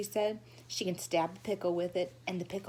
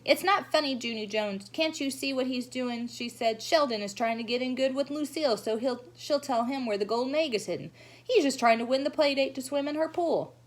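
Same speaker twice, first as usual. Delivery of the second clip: lightly voiced bossy know-it-all; bossy girl; 6 semitones higher, 3 dB louder than usual. May scolding Junie Jones about Sheldon's plan. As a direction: scold her sharply.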